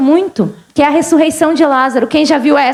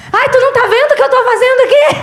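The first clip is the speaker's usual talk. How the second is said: high, fast, comic complaint; mock exasperated wife; 10 semitones higher, faster than usual. Busy wife complains to her husband, mock exasperated. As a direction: playfully imitate a harried wife's scolding.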